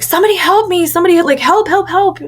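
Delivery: high, panicky call for help; panicked shout for help. Retold or imitated. [name] retelling her panicked cry for help in the sanctuary. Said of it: "I shouted in the middle of the sanctuary".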